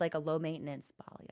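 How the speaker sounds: voiceless conspiratorial whisper; sneaky whisper. Acted. She tempts herself about hair, in a whisper. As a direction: whisper the idea like a guilty secret.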